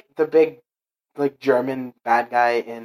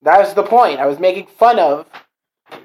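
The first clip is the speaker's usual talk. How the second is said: higher, louder, driving the point home; exasperated insistence; 5 semitones higher, 6 dB louder than usual. Real, not acted. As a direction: explain your joke emphatically.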